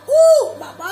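hammed-up wail of distress; mock crying shout. Mother as patient, wailing playfully at the doctor. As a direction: let out a pretend howl. Pretend patient reacts to medicine.

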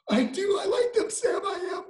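excited cry. Acted. Character's joyful breakthrough.

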